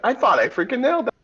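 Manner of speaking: high-pitched, fast mock outburst; mock exasperation. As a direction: squeal it quickly in put-on disbelief. Voices a character's thwarted reaction.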